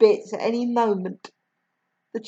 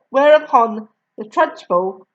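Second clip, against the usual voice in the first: ordinary delivery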